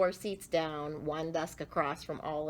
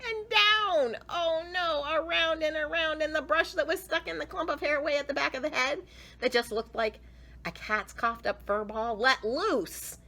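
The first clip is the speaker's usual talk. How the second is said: building excitement; 8 semitones higher than usual. A narrator's reading. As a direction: race faster and higher. Rising disaster.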